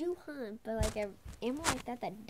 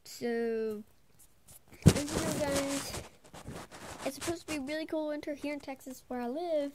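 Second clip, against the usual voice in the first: normal talking voice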